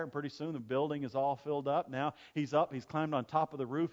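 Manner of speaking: ordinary delivery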